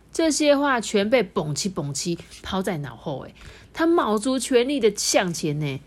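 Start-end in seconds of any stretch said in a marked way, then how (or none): none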